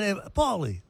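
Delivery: high, soft mock Italian-American greeting; a put-on Italian-American voice, high and soft; Italian accent. Host showing off his Italian accent to Esther. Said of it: "what is that that you just did".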